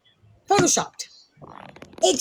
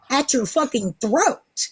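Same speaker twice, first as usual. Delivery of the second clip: ordinary talk, nothing marked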